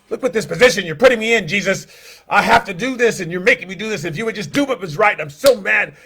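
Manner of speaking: fast, high, loud angry outburst; furious. Acted. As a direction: blame Jesus, boiling over. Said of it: "he's just furious".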